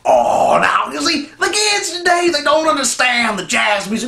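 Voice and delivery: put-on high, loud grumbling old man; old jazz cat. Old man complaining kids don't get jazz, high. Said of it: "voice impressions".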